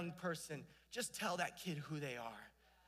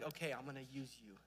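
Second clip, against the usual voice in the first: God's answer, lower and softer, unhurried; calm divine reply; 4 semitones lower, 7 dB quieter than usual. Retold or imitated. God answering someone who says yes, soft low reply. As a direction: answer gently and low, like settled assurance.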